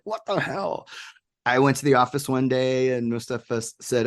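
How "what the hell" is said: high, soft incredulous question; hushed, high-pitched mock astonishment; retold amazement. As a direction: relive stunned disbelief under your breath. Retelling amazement in storage room.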